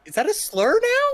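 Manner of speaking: high slow disbelief; incredulous. Real, not acted. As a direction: ask in shock. Incredulous question.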